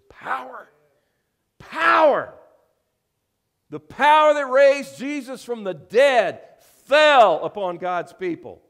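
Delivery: high, shouted pulpit cry building from the first word; full-force preaching. Real, not acted. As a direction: hurl it out to the congregation, slow and very high.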